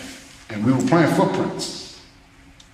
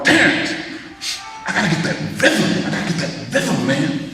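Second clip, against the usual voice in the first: higher, eager voice of the caller; imitating Wayne; 7 semitones higher than usual. Wayne calling Terrence about the rhythm, imitated eager higher voice. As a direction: mimic a friend excited on the phone. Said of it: "That's how he talks".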